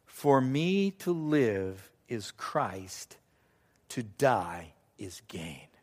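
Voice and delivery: very slow, low and soft; solemn verse quotation. Acted. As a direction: let the scripture land slowly and quietly. Solemnly quotes a great verse.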